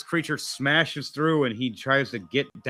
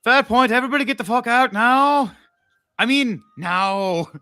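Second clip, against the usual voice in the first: shouted order; 10 semitones higher, 7 dB louder than usual. Acted. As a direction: clear the bar with a bellow. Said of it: "his vision going".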